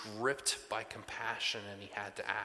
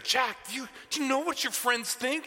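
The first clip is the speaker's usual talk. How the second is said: panicked dad; 14 semitones higher, 5 dB louder than usual. Retold or imitated. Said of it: "I'm like, I'm freaking out".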